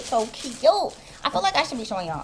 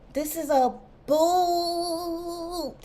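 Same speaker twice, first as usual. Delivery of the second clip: drawn-out delight; 6 semitones higher, 3 dB quieter, much slower than usual. Real, not acted. Savoring the new boots.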